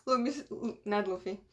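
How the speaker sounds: ordinary delivery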